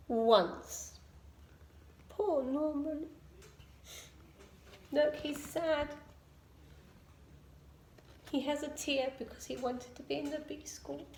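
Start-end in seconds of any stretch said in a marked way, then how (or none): 2.1-11.0 much softer sympathetic aside; soft sorry murmur; tender hush. A narrator's reading. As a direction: soothe the little listener. Sympathetic aside about sad Norman.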